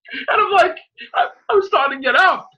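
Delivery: ordinary talk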